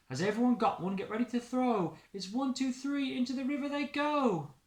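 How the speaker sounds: high, eager countdown; excited girl. Acted. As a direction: build the countdown with glee. Girl starts the race.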